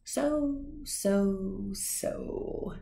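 teasing so, dropping to a hush; fading so so so. A narrator's reading. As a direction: draw it out, fading each time. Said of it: "said the cat in the hat".

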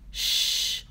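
soft, drawn-out shush; hushing. A narrator's reading. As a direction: gently silence him. Girl hushes shouting boy.

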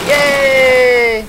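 high loud yay; cheering. Pretend. Mock cheer.

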